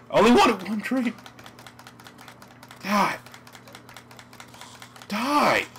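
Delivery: loud, high exasperated yells; frustrated shouting. Real, not acted. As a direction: yell at the game in frustration.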